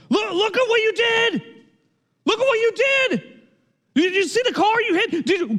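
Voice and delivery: put-on furious young driver yelling about his damaged car; riled-up yell. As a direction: tear into him, young hothead losing his temper. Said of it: "he gets riled up real quick".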